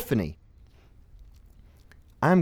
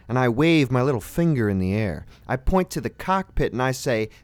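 ordinary talk

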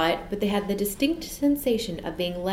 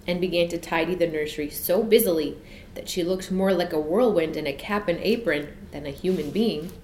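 usual speaking voice

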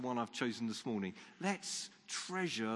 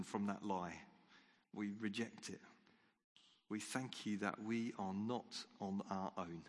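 quiet prayer; 3 semitones lower, 5 dB quieter than usual. Acted. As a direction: pray gently, almost under the breath. Leads a quiet closing prayer.

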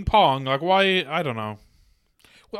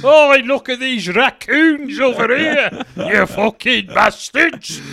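slow, raised comedian impression; higher, louder start, drawn out; Bernard Manning; 5 semitones higher, 5 dB louder, slower than usual. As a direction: jeer slowly as the club comic. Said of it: "That's my Bernard Manning impression".

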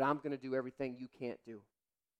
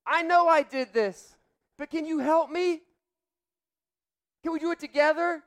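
put-on high, loud desperate kid's cry for help; child pleading; 15 dB louder, slower than usual. Young son begging daddy to help clean the mess, desperate. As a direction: beg your dad for help like an overwhelmed child. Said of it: "He said".

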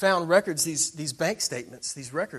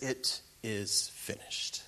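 very slow, soft whisper; movie-style dying words; 3 semitones lower, 7 dB quieter, much slower than usual. Retold or imitated. Jesus hangs in the Passion Play, weak slow whisper. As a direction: breathe it out slowly, softer and lower in energy. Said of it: "doesn't roll off the tongue that way".

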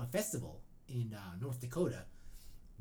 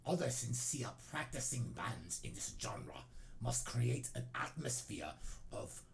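fake Norwegian accent. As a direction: drag it out like a fake-Norwegian metal purist. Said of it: "make a fake Norwegian accent".

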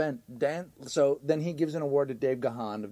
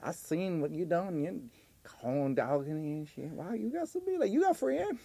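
Corn Doggin; 3 dB quieter than usual. Acted. Corn Doggin joining the mock show, put-on voice rising. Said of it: "then Corn Doggin goes".

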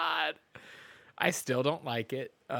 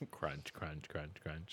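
soft mock crunching noises; whispered crunch sounds; 10 semitones lower, 16 dB quieter, much slower than usual. Imitating chewing sound during silence.

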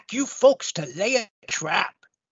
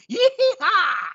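cowboy whoop; 3 semitones higher than usual. Acted.

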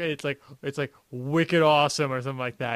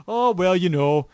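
a louder, accidental upstate New York lilt; upstate inflection; 3 semitones higher, 7 dB louder than usual. Retold or imitated. Son on the phone to dad, slipping into upstate inflection. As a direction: let an upstate accent slip out loudly. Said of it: "an inflection on that".

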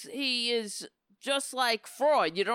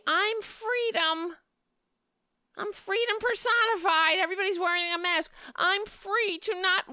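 much higher, airy, ditzy mock voice; high-pitched sneering imitation of the store woman; 9 semitones higher than usual. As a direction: parody a smug shopper, squeaky and high. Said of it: "the dumb bitch in the store".

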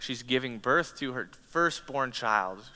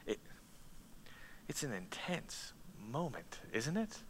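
hushed; 16 dB quieter than usual. Real, not acted. Hushed after God's words.

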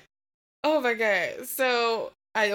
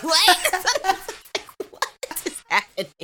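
laughing high through the words; giggly high; 10 semitones higher, slower than usual. Retold or imitated. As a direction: giggle out a dazed yo, what. Laughing-gas high retold.

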